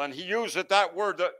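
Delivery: ordinary talk, nothing marked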